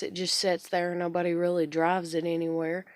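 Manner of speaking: ordinary delivery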